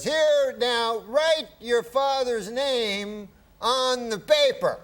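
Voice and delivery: high, very slow Cosby drawl; Bill Cosby impression. Guest imitating Cosby's request to the host, high and slow. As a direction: stretch each word out high like Cosby. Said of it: "gets a paper and he goes".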